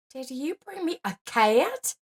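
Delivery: high, shocked disbelief; gasping. A narrator's reading. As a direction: gasp the question out. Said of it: "the lady of the house gasped".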